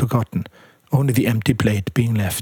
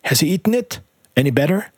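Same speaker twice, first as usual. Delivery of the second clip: shouting; 5 semitones higher, faster than usual. A narrator's reading. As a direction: shout it out on entering. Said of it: "he shouted to old Chuan".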